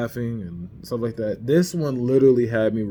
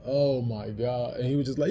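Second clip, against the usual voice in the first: ordinary delivery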